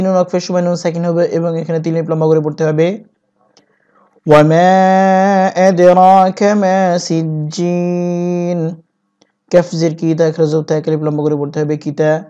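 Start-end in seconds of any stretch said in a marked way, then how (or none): 4.3-8.8 slow melodic tilawat, each vowel drawn out; Quran recitation. Shown as a demonstration. Teacher reciting the verse to students in slow tilawat. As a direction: chant it like a reciter, slow and sung. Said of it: "teacher recites the verse".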